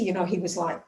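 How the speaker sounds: ordinary talk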